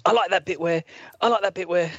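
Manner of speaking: put-on high excited child voice; kid voice. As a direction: talk like an excited little kid. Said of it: "when you're that age".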